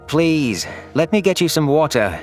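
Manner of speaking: plain reading voice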